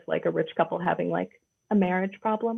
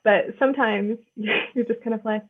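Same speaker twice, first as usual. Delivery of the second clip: ordinary talk, nothing marked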